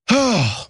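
heavy, falling sigh; exasperated sigh. Real, not acted. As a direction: let out a big sigh to calm down. Sighs before calming himself down.

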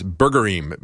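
"Burgerim" as one word ending in -eem; Hebrew plural ending. Shown as a demonstration. Host giving his first guess, "Burgerim" as burger-eem like Elohim. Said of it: "I was gonna pronounce it".

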